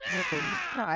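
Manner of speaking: high-pitched mock whine of frustration; frustrated toddler squeal. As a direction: imitate an agitated little kid's "ah". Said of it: "he gets so frustrated".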